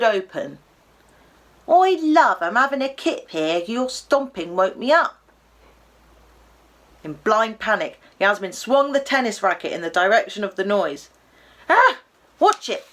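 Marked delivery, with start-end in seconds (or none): 1.7-5.2 gruff Cockney toy llama, woken up; a grumpy Cockney llama roused from a nap; Cockney voice, annoyed at being woken. Acted. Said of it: "in a broad Cockney accent". 11.7-12.9 high, loud startled Cockney cry; Cockney llama dodging a racket; startled yelp. Acted. Llama nearly hit by a racket.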